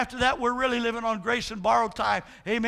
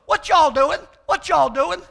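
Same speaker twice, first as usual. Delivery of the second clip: loud, higher squawked "what y'all doing"; parrot voice; 4 semitones higher, 7 dB louder than usual. Retold or imitated. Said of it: "the parrot goes".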